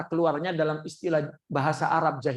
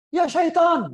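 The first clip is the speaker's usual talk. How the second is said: high, loud startled yell; frightened cry; 11 semitones higher, 7 dB louder, slower than usual. Retold or imitated. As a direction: cry out at a devil. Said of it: "Itu dia takut setan".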